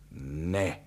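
low, sombre refusal; gloomy. A narrator's reading. Father refusing his son's help, gloomy. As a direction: refuse darkly. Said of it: "pravil pochmurně".

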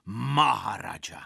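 put-on boys' measured call back; strong-voiced reply. A narrator's reading. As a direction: stretch the word out as a mock-forceful echo. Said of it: "odvětili ještě silnějším hlasem".

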